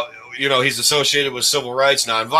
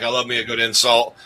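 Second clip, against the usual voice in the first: ordinary talk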